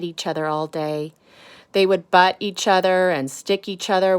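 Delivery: lively picture-book storytelling; reading to children. Acted. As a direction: read it to the kids, expressive and clear.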